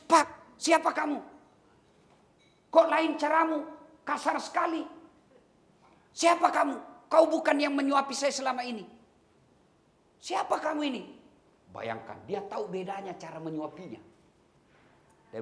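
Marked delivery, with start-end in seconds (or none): 0.0-11.0 high, loud, demanding questions; suspicious blind man. Retold or imitated. Blind man rejecting a rough stranger, high and demanding. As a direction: confront the stranger sharply.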